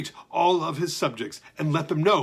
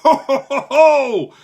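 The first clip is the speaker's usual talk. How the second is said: huge, loud, high jolly ho-ho; booming laugh; 9 semitones higher, 10 dB louder than usual. Acted. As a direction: bellow out a happy giant laugh. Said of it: "tremendous bellow of happiness".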